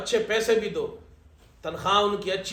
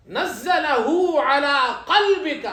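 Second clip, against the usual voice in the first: slow, louder melodic Quran recitation; 5 semitones higher, 6 dB louder, much slower than usual. Shown as a demonstration. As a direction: chant the verse slowly and fully.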